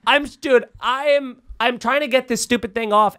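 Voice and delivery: usual speaking voice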